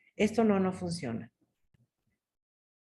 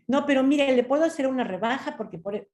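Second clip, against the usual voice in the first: higher, quick salesman insistence; insistent salesman; 5 semitones higher, faster than usual. Retold or imitated.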